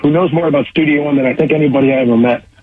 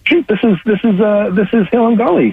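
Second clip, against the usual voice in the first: retold sudden recognition; 6 semitones higher than usual. Recalling the moment he recognized the track, surprised. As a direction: act out placing a familiar tune.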